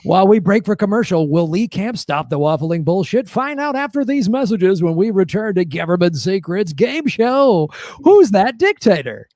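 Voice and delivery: projected host going to commercial; punchy announcer tease, building to the show title; game show voice. Acted. Host throwing to the break, game show voice. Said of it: "when you do the game show voice".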